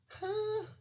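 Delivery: high, soft taunting huh; teasing huh. Real, not acted. Quizzing viewers who figured it out, teasing. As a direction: tease them with a quick high huh.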